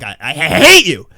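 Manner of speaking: slow, stretched mock loathing; hateful. Telling little Joey how much it disgusts him, hateful. Cursing own body part.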